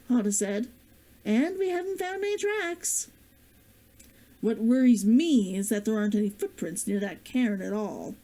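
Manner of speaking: ordinary delivery, nothing marked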